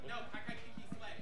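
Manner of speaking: fast, breathy whisper aside. Real, not acted. Whispering her pick to the queen beside her. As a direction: hush it to the panel. Aside under the panel chat.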